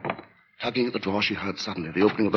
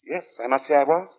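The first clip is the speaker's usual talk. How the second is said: sinister lodger; 8 semitones higher than usual. Acted. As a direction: speak like a polite man hiding menace. Narrator voices the lodger Sleuth.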